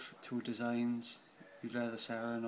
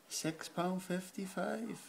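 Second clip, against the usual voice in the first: raised, drawn-out price call; high slow voice; 8 semitones higher, slower than usual. Acted. Announcing the price.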